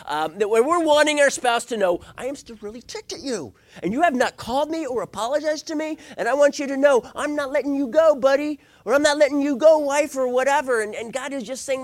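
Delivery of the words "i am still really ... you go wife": high-pitched, mounting mock indignation; acted resentment. Spouse still ticked and awaiting apology, mock-angry. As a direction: needle your spouse with a hammed-up grudge.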